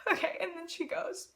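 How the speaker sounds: high, choked laugh-crying; half laughing, half crying, real; laughing tearfully. Real, not acted. Telling viewers what Taylor said, real tearful laughter. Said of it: "laugh cry".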